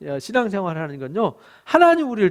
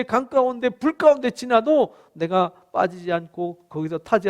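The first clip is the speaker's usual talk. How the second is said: higher-pitched, insistent declaration of love; God's pleading love; 5 semitones higher than usual. Acted. God pleads with his beloved people, impassioned and insistent. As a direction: build from tender protest to emphatic, rising vow. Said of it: "하나님이 막 화가 나셨다가".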